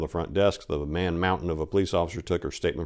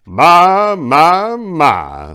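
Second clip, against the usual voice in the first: loud booming drawn-out my my my; thundering; 11 semitones higher, 15 dB louder, much slower than usual. Acted. Old mayor greeting Maria, booming loud. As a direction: boom it across the room. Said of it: "thundered the cagey baritone".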